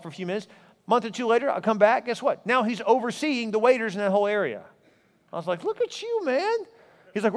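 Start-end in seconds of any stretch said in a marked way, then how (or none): 5.6-6.6 amazed. Retold or imitated. Impressed greeting retold in story.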